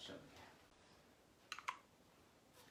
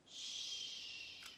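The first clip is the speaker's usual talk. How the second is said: soft whispered shush; hushing shh; 7 dB quieter than usual. Acted. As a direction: hush them to sleep. Bedtime hush.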